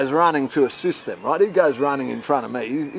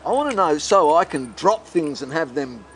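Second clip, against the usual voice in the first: ordinary talk, nothing marked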